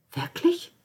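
high rising disbelief; astonished. A narrator's reading. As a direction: ask it in wide-eyed amazement. Astonished question.

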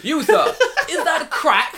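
high, loud hammed-up crackhead; London crackhead. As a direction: shriek it like a cartoon addict. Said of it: "that's how crackheads talk in London".

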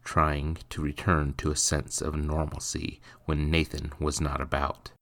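ordinary talk, nothing marked

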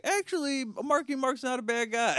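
high-pitched put-on voice; mock forgiving victim. Comic imitating the victim who forgives [name], high mock voice.

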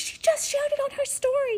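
high-pitched imitation of a breathless tattler; mock kid's squealed report; urgent tattling. Kid reporting the posted story to mom, urgent tattling.

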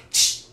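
sharp loud shush; a quick corrective shh; shushing sound. Shown as a demonstration. Dog trainer correcting a dog, sharp shush. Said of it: "he makes the ch sound".

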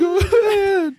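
high, loud fake wail; hammed-up crying agreement; mock sob. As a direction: cry the word out in fake tears.

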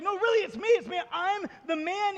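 high-pitched, eager protest; acted excitement. Healed man to people who don't think it's him, excited. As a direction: plead excitedly to be believed. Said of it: "He's so excited".

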